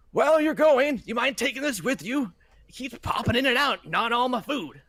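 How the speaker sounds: put-on high, loud, fed-up old man asking a favour; old caretaker begging them to take the pest; exasperated old man. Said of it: "and says".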